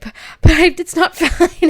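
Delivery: high, loud whimpering protest breaking into a laugh; to the boyfriend on the phone, a high laughing whine; whiny laughing protest. Retold or imitated. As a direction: whine it like a panicked kid, half laughing.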